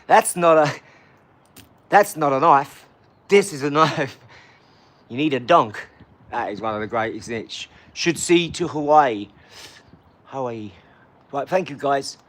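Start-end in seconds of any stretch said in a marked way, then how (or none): 0.1-5.9 booming, exaggerated Australian accent; loud and broad, a hammed-up Australian swagger; mock Australian accent. Showing off a knife line, booming mock Australian accent. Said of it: "that accent is outrageously big". 6.3-10.7 mock Australian accent. Joking for Australian fans, low mock Australian accent. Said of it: "that accent is outrageously big".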